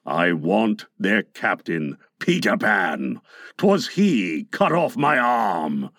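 deep, slow, vengeful pirate; passionate Captain Hook. A narrator's reading. Hook tells Smee of his lost arm, low slow passion. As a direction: dwell darkly on the grudge. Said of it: "Hook was saying passionately".

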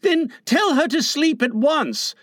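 put-on high boyish demand; almost ordering. A narrator's reading. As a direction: order it as an insistent small boy. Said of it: "John almost ordered".

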